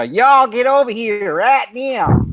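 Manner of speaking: put-on high, slow Southern US accent; loud, stretched-out Southern holler; Schizo's drawl. Imitating a co-host calling people over, drawn-out Southern accent. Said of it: "speak just like, um, just like Schizo".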